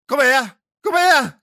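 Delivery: high-pitched challenge to come back; hammed-up summons; mock calling out. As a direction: call after someone riding off, played for laughs. Retold calling after the rider.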